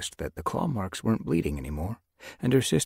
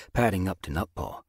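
ordinary delivery

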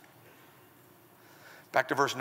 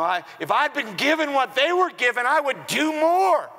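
mock excuse; 7 semitones higher than usual. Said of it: "you're like".

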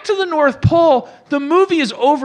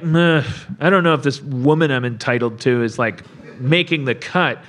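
mock unimpressed; 11 semitones lower, 3 dB quieter than usual. Acting Santa unimpressed by his date.

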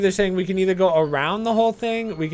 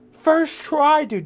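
proud boast; 10 semitones higher, much slower than usual. Real, not acted. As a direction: brag it out, pitched way up. Bragging again, higher.

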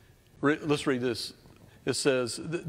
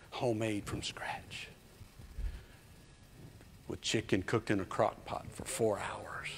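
tempting whisper; 3 semitones lower, 10 dB quieter than usual. Acted. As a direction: whisper it to make them hungry. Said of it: "teasing hungry kids".

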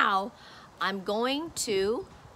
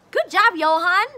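excited praise; 6 semitones higher, 6 dB louder, faster than usual. Real, not acted. Praising child.

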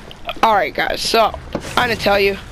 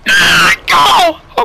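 startled yell; 2 semitones lower, 9 dB louder than usual. Real, not acted. Jump scare in game.

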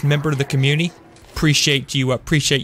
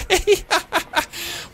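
laughing. Real, not acted. Reacting to viewer's odd question.

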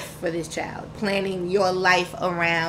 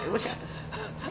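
laughing; 12 dB quieter than usual. Real, not acted. Laughing at her own punchline.

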